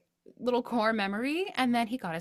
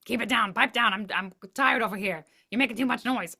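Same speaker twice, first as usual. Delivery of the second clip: grumpy sleepy hand; 3 semitones higher, 5 dB louder than usual. Pretend. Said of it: "the other hand kept popping up and saying".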